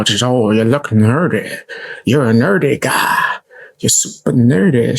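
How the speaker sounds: ordinary talk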